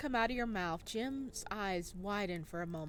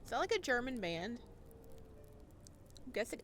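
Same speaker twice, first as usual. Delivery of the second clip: normal talking voice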